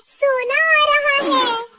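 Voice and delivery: ecstatic. Acted. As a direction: stretch it out in greedy delight. Crows expect gold from cow. (लालच भरी खुशी से खींचकर)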